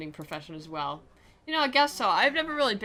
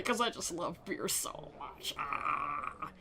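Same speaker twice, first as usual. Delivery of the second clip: mock bliss; 2 semitones lower, 6 dB quieter, much slower than usual. Playing up joy at beer.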